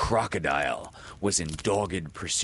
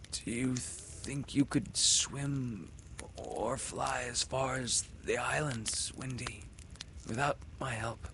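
weak and gentle; 5 semitones higher, 6 dB quieter than usual. Acted. Wounded Peter asking Windy softly. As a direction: ask gently, already fearing.

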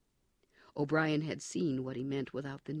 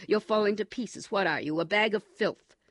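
louder, higher, jeering disgust; contemptuous taunt; 6 semitones higher, 10 dB louder than usual. Acted. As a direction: spit the scorn at him. O'Brien taunts broken prisoner.

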